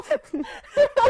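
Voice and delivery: a high, giggling laugh; high-pitched burst of laughter. Real, not acted. Laughing at her own story.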